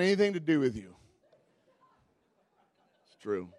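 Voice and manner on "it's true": ordinary talk, nothing marked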